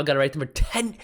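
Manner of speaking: ordinary talk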